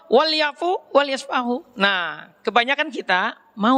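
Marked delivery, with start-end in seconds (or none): 0.1-1.6 high, slow recitation of the Arabic verse; slow chanted Arabic quote in a sermon; Quran recitation. Shown as a demonstration. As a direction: intone the verse slowly, like reciting scripture.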